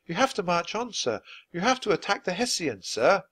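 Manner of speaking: put-on high, urgent girl's plea; high-pitched mild acted shout. A narrator's reading. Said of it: "she shouted".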